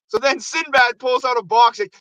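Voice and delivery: ordinary talk, nothing marked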